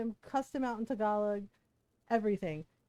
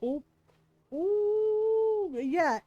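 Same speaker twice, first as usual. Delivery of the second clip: impressed ooh; 9 semitones higher, 5 dB louder, much slower than usual. Retold or imitated. Reacting to mom's roast.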